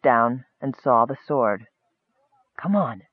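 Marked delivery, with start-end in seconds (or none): 2.6-3.0 softer, understated urging; quiet, mild prompt; gentle urging. A narrator's reading. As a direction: coax softly. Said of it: "the elf urged".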